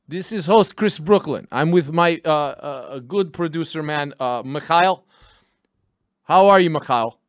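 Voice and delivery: host in a hammed-up Russian accent. Host greeting his producer on the renamed show, Russian accent. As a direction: play a Russian host with broken English. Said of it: "I wanted to do the accent for a while".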